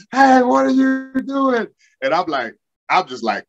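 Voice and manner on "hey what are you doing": high, drawn-out holler in imitation; mock coach outrage. Coaches hollering at him after a practice hit, mock outrage. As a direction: stretch it high like an exasperated coach.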